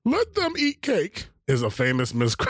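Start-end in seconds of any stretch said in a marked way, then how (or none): 0.0-1.3 high, slow put-on foreign accent on the quote; mock French-ish accent. Quizmaster reading the quote to his friend, in a hammed-up vague accent. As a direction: hams up the famous line in a vague foreign accent. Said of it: "I don't know what the accent was".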